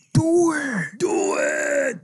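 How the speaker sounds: intense motivational shout, high and loud; Shia LaBeouf. Acted. Co-host shouting a famous catchphrase for laughs, loud impression. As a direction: yell it like a hammed-up hype man. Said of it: "Shia LaBeouf going, you know, his like".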